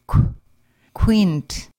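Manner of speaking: "quint" with a kw as in queen; rounded kw. Shown as a demonstration. Teacher modelling rounded K for learners, kw. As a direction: round the lips on "qu-". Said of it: "K raised W as in the word queen".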